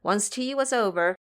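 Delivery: ordinary talk, nothing marked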